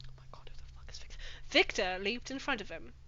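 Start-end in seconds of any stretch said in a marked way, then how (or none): 0.1-1.2 fast unvoiced whisper. Real, not acted. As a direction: whisper the aside quickly. Whispered aside.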